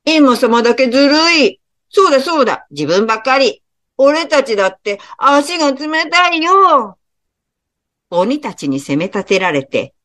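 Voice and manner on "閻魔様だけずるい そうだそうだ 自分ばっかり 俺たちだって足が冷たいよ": higher, whiny ogres protesting that it's unfair; sulky little demons complaining; whining ogres. Acted. (不満げに高い声で騒ぐ鬼たち)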